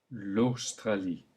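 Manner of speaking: L'Australie slowly, au as a clear o; slow French AU. Shown as a demonstration. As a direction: model it slowly. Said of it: "repeat one last time".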